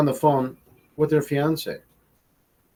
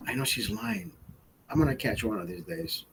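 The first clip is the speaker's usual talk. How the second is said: muttered thought; 12 dB quieter than usual. Retold or imitated. Private suspicious thought.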